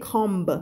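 "comb" said as "komb", short o and sounded b; wrong vowel demo. Shown as a demonstration. Teacher showing another mistake, "comb" as "kom-b". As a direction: say "comb" like "bomb" spelt out with its b. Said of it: "แล้วก็ไม่ใช่ comb".

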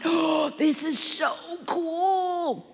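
gasp, then stretch out the wonder; thrilled child. Retold or imitated. Child delighted with her mischief.

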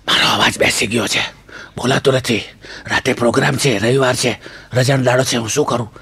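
low, voiceless hoarse whisper; imitating a laryngitis rasp; lost voice. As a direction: croak it as if the voice is gone. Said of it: "સવારના પર હું ઉઠ્યો ને અવાજ બેઠો".

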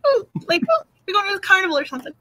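put-on fast, high kid going to the carnival; little kid excitement. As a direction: rattle it off like an excited child. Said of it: "I felt like a little kid".

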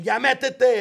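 retold motherly shout; imitated mom's scream. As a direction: yell it out like a mother ordering someone inside. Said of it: "my mom would scream".

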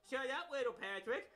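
ordinary talk, nothing marked

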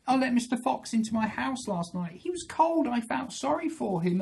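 high-pitched, pleading little rabbit; upset rabbit. Acted. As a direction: pour out your woes as a storybook rabbit. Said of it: "She said".

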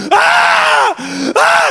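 piercing high shrieks; loud acted-out screams; imitated screaming. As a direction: scream it full out, non-stop. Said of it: "stop screaming".

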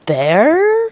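high, doubtful questioning bear?; doubtfully. A narrator's reading. Housekeeper doubting the bear, doubtful. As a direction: sceptical rise on bear?. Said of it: "said Missus Bird doubtfully".

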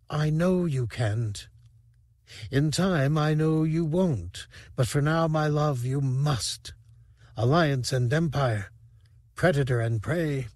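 a fading alien rebel calmly letting her beloved go; dying alien lover, tender. A narrator's reading. Dice's farewell to Sivrak.

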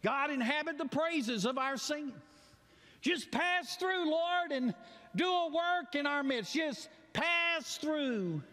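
high, fervent prayer, slowing to a shout. Acted. Congregation praying to God in worship, fervent rising plea. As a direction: plead with God to pass through.

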